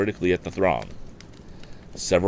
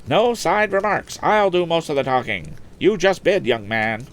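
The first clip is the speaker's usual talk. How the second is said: high, brisk auction call selling the boat; auctioneer's patter; 12 semitones higher, 4 dB louder than usual. Acted. Auctioneer selling a motorboat to the lakeside crowd, high patter. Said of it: "remarked Mister Wood".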